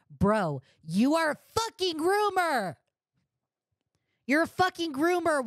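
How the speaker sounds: angry yelling. Real, not acted. Streamer berating the user on stream, high furious yell. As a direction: yell it at him in disgust.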